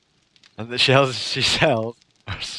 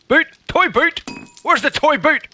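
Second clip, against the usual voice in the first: higher, louder silly cry; comic outburst; 13 semitones higher, 7 dB louder than usual. Real, not acted. After failing the twister, a loud silly cry. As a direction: shout it like you lost the toy.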